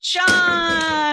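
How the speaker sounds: loud, high winner announcement; excited shout. Real, not acted. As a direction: call out the winner's name with joy.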